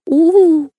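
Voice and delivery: high fake wailing howl. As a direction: wail high and loud, putting it on. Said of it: "Хорош выть".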